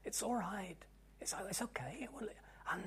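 tender unvoiced comfort; gentle whisper. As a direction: hush like a hand on the saddle. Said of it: "she said so gently".

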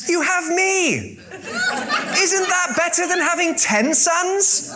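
high, slow, laughing smug husband; Captain Empathy. Retold or imitated. Elkanah consoling weeping Hannah, high slow mock smug voice. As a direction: drag it out like a smug clueless hero. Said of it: "Captain Empathy here".

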